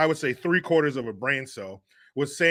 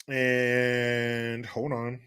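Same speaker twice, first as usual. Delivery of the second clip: slow drawl; 3 semitones lower, 4 dB quieter, much slower than usual. Real, not acted. Drawn out while searching.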